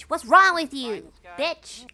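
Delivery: normal talking voice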